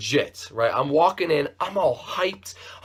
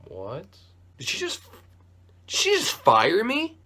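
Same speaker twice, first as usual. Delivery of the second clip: much higher, stunned questioning; stunned disbelief; 5 semitones higher than usual. Real, not acted. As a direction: rise up high in shock. Shocked at being fired.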